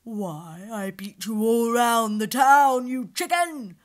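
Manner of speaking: loud angry lion; loud, furious lion; angry retort. Acted. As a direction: snap back furiously. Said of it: "the lion replied angrily".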